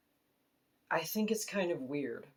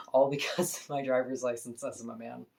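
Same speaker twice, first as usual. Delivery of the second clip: words broken by real laughs; laughing while talking; 3 dB louder than usual. Real, not acted. To the viewers about the hostel, laughing. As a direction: chuckle through the sentence. Laughing at own awkward situation.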